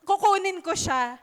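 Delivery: determined cry; desperate plea. Acted. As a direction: insist she will take him. Acting Mary's desperate plea.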